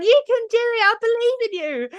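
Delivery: high-pitched mock child encouragement; quick, high squeaky-keen urging; cheering kids. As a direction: cheer like eager kids, imitating them. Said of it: "And the kids were going".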